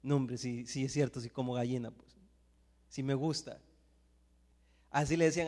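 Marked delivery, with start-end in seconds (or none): 0.0-3.6 wry admission. Retold or imitated. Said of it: "y hoy digo yo".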